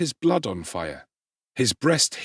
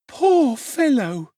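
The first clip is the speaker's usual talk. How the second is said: piteous; 5 dB louder, much slower than usual. A narrator's reading. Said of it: "looking piteously".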